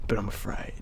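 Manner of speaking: timid unvoiced whisper; afraid whisper. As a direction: whisper the fear sheepishly. Confessing fear to partner.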